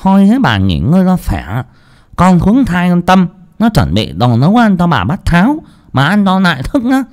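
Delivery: high coaxing cook voice; fussy lisping cook. Acted. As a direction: coax the mistress to rest. Cook fusses over mistress.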